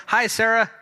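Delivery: loud, high call across the room; yelling a greeting. Shown as a demonstration. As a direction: call out to her so she hears. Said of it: "Can you yell".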